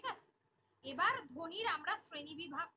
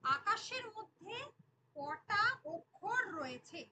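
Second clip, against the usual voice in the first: slow, drawn-out question to the class; slow quiz question; 5 semitones higher, 3 dB louder, slower than usual. Acted. Teacher asking students how many syllables, slowly. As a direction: ask it slowly, giving students time to think.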